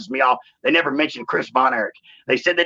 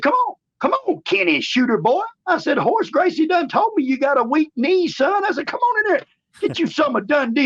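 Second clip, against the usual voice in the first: hammed-up heel taunt; 13 semitones higher than usual. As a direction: goad him like a cocky heel cutting a promo. Taunting Shamrock to fight.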